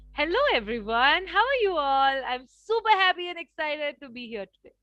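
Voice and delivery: high, loud, bubbly welcome; super excited greeting. Shown as a demonstration. Communication coach greeting a session the right way, happy and excited. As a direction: burst in full of enthusiasm. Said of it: "And I can talk like".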